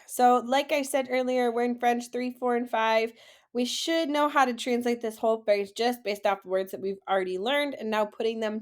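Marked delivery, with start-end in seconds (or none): none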